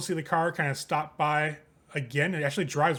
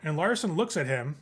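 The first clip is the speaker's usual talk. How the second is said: ordinary delivery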